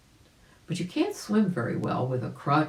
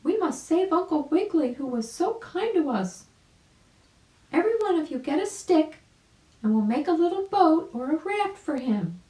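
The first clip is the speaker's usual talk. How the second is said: very high, louder urgent bird voice; mama bird urging; 13 semitones higher, 3 dB louder than usual. A narrator's reading. Mama bird urges her chicks, very high and urgent. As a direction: hurry the little ones along. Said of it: "she cried".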